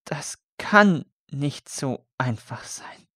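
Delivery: a prisoner breaking down in tears; slow tearful disbelief. A narrator's reading. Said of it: "brach in Tränen aus".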